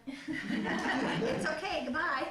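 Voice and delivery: a very slow, loud, laughing farewell; laughing goodbye. Retold or imitated. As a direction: draw the goodbye out through laughter.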